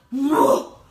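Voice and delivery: loud, blown-away exclamation; overwhelmed. Retold or imitated. Woman hit by emotion after the encounter, overwhelmed. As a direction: burst out as if knocked back.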